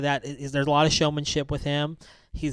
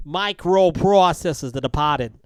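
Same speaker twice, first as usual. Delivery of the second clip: loud, slow, higher Boston accent; exaggerated Boston accent; 4 semitones higher, 7 dB louder than usual. Shown as a demonstration. Host shows off his movie line in a hammed-up Boston accent. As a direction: ham up the Boston accent, drawn out. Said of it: "Are you doing a Boston accent".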